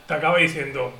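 usual speaking voice

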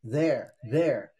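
their said slowly, clearly; English their. Shown as a demonstration. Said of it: "their é deles ou delas".

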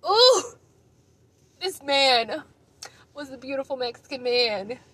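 loud high ooh; swooning. Real, not acted. Swooning over him.

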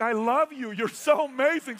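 loud, high-pitched retold gushing; gushing delight. Customer to Romanian front desk clerk, loudly gushing. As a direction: act out being thrilled by a new friend.

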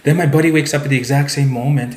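ordinary talk

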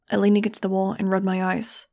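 plain reading voice

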